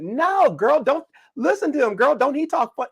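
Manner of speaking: high, sassy kid imitation; fifth-grade girl. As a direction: argue back like a little girl calling in her friend.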